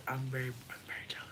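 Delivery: quick confiding whisper; fast secretive whisper. Real, not acted. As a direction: confess it in a whisper. Confiding jealousy.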